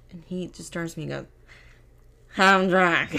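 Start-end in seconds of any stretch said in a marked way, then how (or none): 2.4-3.2 loud, slurred mock drunk; drunk imitation. Friend mimics sleepy Grant turning to answer, loud drunken slur. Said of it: "You sound like you're drunk".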